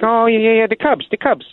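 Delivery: high, eager mock caller; the odd caller scrambling to sound like a real fan; prank caller voice. Caller pretends to want a score, high mock voice. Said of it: "And he's like".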